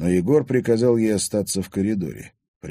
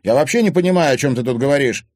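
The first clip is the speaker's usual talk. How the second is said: loud, high-pitched protest; shouting; 8 semitones higher, 7 dB louder, faster than usual. A narrator's reading. Timofey protesting to his stepmother, shouting. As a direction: yell it in indignant bewilderment. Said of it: "крикнул Тимофей".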